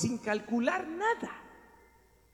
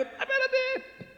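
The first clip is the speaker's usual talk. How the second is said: startled stop; much slower than usual. Acted. Jesus stops Magdalena.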